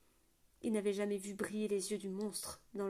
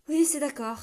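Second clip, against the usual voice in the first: giving in; 5 semitones higher, 9 dB louder than usual. A narrator's reading. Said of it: "céda-t-il".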